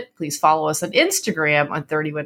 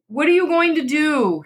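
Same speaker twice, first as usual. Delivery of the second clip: ordinary delivery, nothing marked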